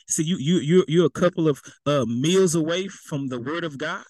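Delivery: ordinary talk, nothing marked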